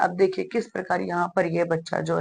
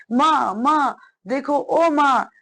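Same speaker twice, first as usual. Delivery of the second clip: slow, higher moaning call; groaning in pain; 5 semitones higher, slower than usual.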